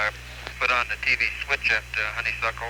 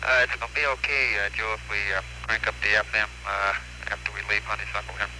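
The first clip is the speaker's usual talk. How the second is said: radio transmission. Real, not acted. Crew answers over radio link.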